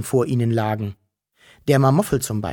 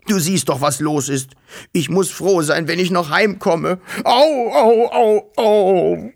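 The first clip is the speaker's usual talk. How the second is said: higher, plaintive whine breaking into loud ows; pitiful wailing; 5 semitones higher, 4 dB louder than usual. A narrator's reading. Hurt father showing Wischel his foot, pitifully wailing. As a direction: complain miserably, then wail in pain. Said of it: "Es klang so kläglich".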